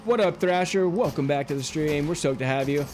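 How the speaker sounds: usual speaking voice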